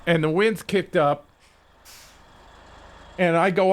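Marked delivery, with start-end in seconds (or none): none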